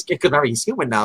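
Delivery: ordinary delivery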